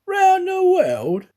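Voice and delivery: high, slow, loud astonished cry. Acted. Detective cries out in disbelief at the valet's news. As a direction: stretch it out in disbelief. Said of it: "cried Fix".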